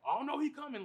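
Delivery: high, loud mock panic at the screen; high hammed-up alarm; mocking a loud theater talker. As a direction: play the guy narrating the movie out loud. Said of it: "just fucking narrating shit".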